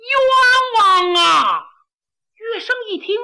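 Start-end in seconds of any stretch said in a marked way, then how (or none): 0.0-1.6 long, drawn-out, very slow wail, much higher and louder; shouting at the top of lungs. A narrator's reading. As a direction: stretch the cry out as far as the voice goes. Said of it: "扯着嗓子喊".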